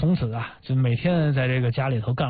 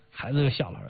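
normal talking voice

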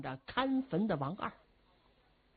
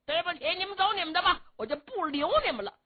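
higher, fast cocky brush-off; brusque dismissal; 10 semitones higher, faster than usual. Acted. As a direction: wave them away quickly. Zhu Can dismisses officers.

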